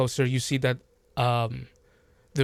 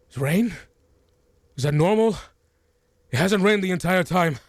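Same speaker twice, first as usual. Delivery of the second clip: raised, anxious group member; alarmed about the strange rain; worried villager; 6 semitones higher, 5 dB louder than usual. Acted. Villager asking the others, anxious. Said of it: "one of the people in the group remarks, says".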